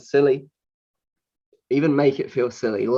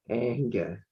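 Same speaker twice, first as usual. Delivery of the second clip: anger softer and softer, half laughing; fading into silliness; 5 dB quieter than usual. Shown as a demonstration. Coach repeating anger until it sounds silly, fading softly. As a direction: let anger go limp and silly. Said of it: "until it just feels silly to say that word".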